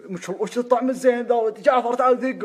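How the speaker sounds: delighted by the food; إعجاب متحمس. Retold or imitated. الفضل يتذوق الطعام متحمساً. (الفضل يتذوق الطعام بحماس وصوت عالٍ)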